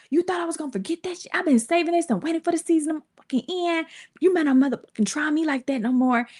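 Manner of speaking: high, fast mock tirade; retold angry confrontation. Girlfriend warns Kay off, fast angry rant. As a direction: imitate her rattling off a threat.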